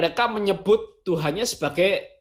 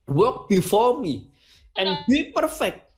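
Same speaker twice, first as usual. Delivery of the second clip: slow, raised English quote, climbing on be; voicing El Shaddai's command in English, slow and raised; solemn divine command again; 4 semitones higher, slower than usual. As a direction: speak it as God's decree, deliberately. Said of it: "Itu kata Tuhan".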